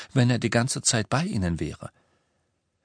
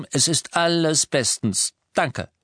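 higher BESTENS, cutting the question off; emphatic; 8 semitones higher, 3 dB louder than usual. A narrator's reading. Lupin shutting down Hermine's question, emphatically. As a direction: put firm weight on "Es ist alles bestens". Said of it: "sagte Lupin nachdrücklich".

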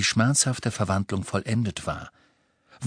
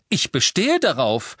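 pushy, raised and combative; aggressive; 5 semitones higher, 4 dB louder than usual. A narrator's reading. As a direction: insist with a challenge. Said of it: "sagte Hermine angriffslustig".

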